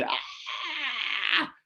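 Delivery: a long, high mock scream of a child; imitated child scream. Imitating a child screaming at the X-ray. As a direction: stretch out a high, slow child's cry. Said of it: "niños que salen así gritando".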